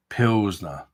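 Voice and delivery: ordinary talk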